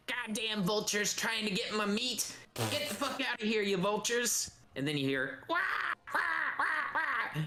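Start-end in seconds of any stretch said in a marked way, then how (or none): 0.1-4.5 angry hag. Acted. The hag yells at the vultures, high cranky voice. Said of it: "you hear a woman's voice saying". 5.5-7.4 high squawking wah wah; vulture cries. Acted. GM voices the vultures answering, high squawks.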